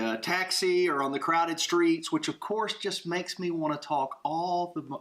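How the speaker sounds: normal talking voice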